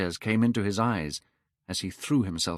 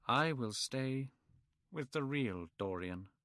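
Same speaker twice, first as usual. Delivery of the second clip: sadly; 3 semitones higher, 12 dB quieter than usual. A narrator's reading. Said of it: "he said sadly".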